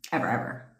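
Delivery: ordinary talk, nothing marked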